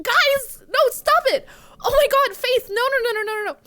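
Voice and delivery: high, loud, flustered pleading; real protest at too much generosity; overwhelmed protest. Real, not acted. Streamer begging viewers to stop donating, overwhelmed protest.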